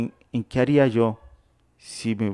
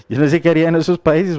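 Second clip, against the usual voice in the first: laughing through a fast ramble; amused rush of words; 4 semitones higher, 4 dB louder, faster than usual. Real, not acted. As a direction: chuckle while saying it, quick. Laughing repeat of previous line.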